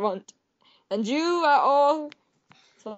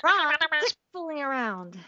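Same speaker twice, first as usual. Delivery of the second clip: light cartoon-heroine scolding; Kim Possible impression; 3 semitones higher than usual. Playing Kim Possible scolding Ron, lightly voiced. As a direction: scold your sidekick like a teen hero. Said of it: "my Kim Possible impression".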